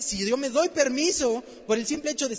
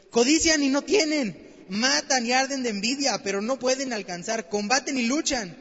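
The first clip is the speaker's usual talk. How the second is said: louder, driving preacher's cry; louder preaching on the verse's sins; full-force preaching; 6 dB louder than usual. Acted. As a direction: hammer each verb home from the pulpit.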